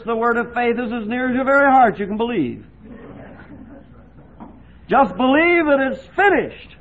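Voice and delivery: loud, high, fervent pulpit voice; full-force preaching. Acted. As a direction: preach it out to the hall with fire.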